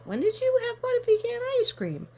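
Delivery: high loud mock-shocked mother; high shrill-free surprised mother voice; shocked mom. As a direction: squeal it like an astonished mom. Mother's surprised question retold.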